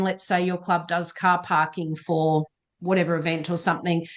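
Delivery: normal talking voice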